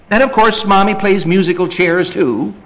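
ordinary talk, nothing marked

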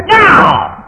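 put-on loud high child's refusal; child refusing parent, loud and high; defiant no. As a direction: shout it back, defiant.

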